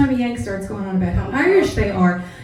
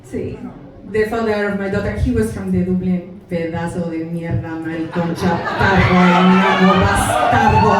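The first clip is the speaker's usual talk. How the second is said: put-on low Mexican-accented aside turning into Spanish insults; Mexican mother, cursing; 5 semitones lower than usual. Mother curses her daughter's father, bitter Mexican accent. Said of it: "But my mother was as bad".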